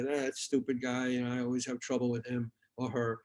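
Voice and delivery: quiet, lightly acted griping under your breath; grumbling. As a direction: grumble like a disgruntled reporter walking off. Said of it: "go away grumbling".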